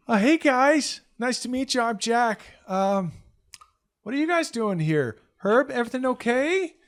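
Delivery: put-on high boy voice with slight worry; a little concern. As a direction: greet them then worry about Herb. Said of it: "A little concern in his voice".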